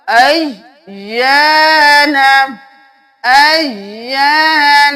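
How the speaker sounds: slow chant, Ayan with the doubled ya held; Quranic recitation. Shown as a demonstration. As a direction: recite with the shadda pronounced twice. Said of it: "تشدید ہے تو دو بار ادا ہوگا".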